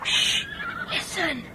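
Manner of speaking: urgent hushed whisper; boy hushing his friends in the dark field. Acted. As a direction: hush them fast so nobody hears. Hiding in field, hearing a noise.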